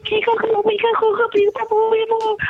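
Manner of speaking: high, chanting fake Japanese cheer; hammed-up high chant of gibberish Japanese; mock cheering crowd. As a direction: chant it like a crowd egging her on. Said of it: "cheer her on".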